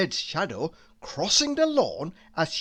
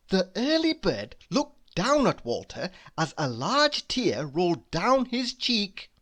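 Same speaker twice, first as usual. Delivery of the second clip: usual speaking voice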